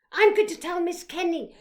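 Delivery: put-on high, loud cry of a tattling child; shouting. A narrator's reading. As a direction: call it out loudly, threatening to tell. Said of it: "shouted Brenda".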